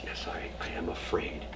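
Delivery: soft, trembling whisper of a dying rabbi; frightened whisper. Acted. Dying Rabbi Zusia answers his disciples, fearful whisper. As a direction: barely voice it, afraid. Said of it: "you're trembling, you're shaking".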